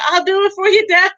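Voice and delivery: put-on very high, loud childish voice; mock deformed son.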